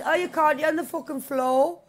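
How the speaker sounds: ordinary talk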